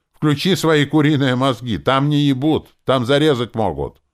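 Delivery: high, loud exasperated warning; angry shouting. A narrator's reading. Druid warns Lenora of danger, shouting angrily. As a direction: drive each phrase home at full voice.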